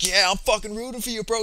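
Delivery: excited bro-to-bro backing; mock hyped support. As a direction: imitate a fighter hyping up his new best friend. Acted sudden show of support.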